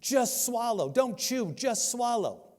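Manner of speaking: high mock crowd yell, egging her on. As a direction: retell the teens hollering from the audience. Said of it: "start yelling".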